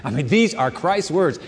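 ordinary talk, nothing marked

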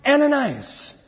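high, drawn-out name call; high, lilting summons. Acted. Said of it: "the voice of the Lord".

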